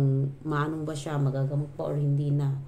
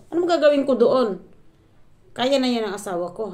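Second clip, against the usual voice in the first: louder, higher exasperation; exasperated reply; 6 semitones higher, 7 dB louder than usual. Retold or imitated. Vlogger's exasperated retort to her mother-in-law, loud. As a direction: throw up her hands in the argument. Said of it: "Kaya sabi ko".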